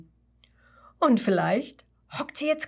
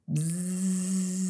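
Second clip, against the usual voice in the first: bee buzz. Acted. A bee answers.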